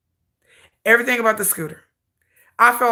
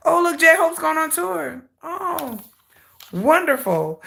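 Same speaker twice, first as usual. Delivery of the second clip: high, slow whimpering fake cheer; mock crying; 6 semitones higher, slower than usual. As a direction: pretend to weep over it. Salty mock congratulations.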